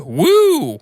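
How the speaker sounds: loud, high-pitched whoop; high shivery "woo". Acted. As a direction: let out a sudden high "woo". Host character suddenly feels chilly.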